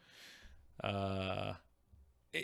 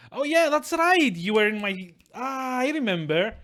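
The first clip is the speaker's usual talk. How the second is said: much higher pitch, suddenly remembering; delighted recognition; 10 semitones higher, 5 dB louder than usual. Real, not acted. Suddenly recognising a returning viewer.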